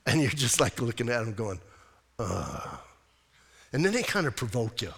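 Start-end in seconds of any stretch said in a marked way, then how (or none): 2.2-2.8 disgusted ugh. Retold or imitated. Reacting to spiritual show-offs.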